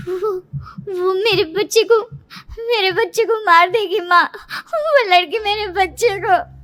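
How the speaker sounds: high, tearful, stammering fear; tearful panic. Acted. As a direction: plead to your mother, still gripped by the nightmare. Dazed daughter, terrified after nightmare.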